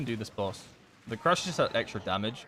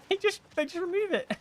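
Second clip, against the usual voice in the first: laughing. Real, not acted. Laughing through line.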